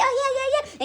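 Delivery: high eager yeah yeah; eager agreement. Retold or imitated. Friend voiced agreeing.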